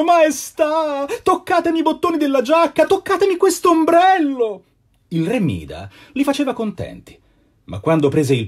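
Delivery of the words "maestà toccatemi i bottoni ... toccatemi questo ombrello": much higher, eager cheering guests; guests pleading. A narrator's reading. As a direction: call out to the king with excitement. (invitati che supplicano il re, voce molto più acuta)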